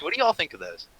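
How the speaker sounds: normal talking voice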